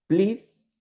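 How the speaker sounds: a lifted, courteous please; polite please. Shown as a demonstration. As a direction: ask nicely, with a lifted "Please". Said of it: "with, you know, politeness".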